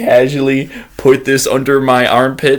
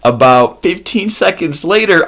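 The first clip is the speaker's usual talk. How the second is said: ordinary talk, nothing marked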